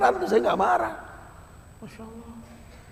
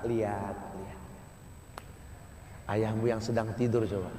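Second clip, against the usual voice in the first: much softer, lower, tender sermon voice; hushed tender preaching; 7 semitones lower, 11 dB quieter than usual. Acted. Preacher softly asks listeners to look at sleeping father, hushed and tender. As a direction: draw them in quietly, with feeling.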